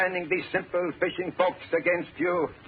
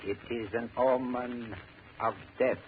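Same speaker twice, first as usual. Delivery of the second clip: ominous prophecy; 7 semitones lower, 5 dB quieter than usual. Acted. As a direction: intone it like a dark prophecy. Prophet intones a portent.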